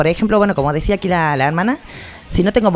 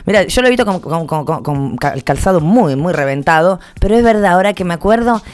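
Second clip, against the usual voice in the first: ordinary delivery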